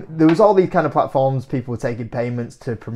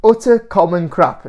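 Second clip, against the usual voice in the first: slow, drawn-out scornful quote; mocking read-out; 4 semitones higher, 6 dB louder, slower than usual. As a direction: read it slowly with mock disdain. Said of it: "which says".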